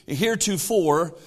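ordinary delivery

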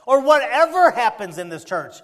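loud with a laugh in it; jolly preacher laugh; laughing excitement. Real, not acted. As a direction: burst out laughing as you say it.

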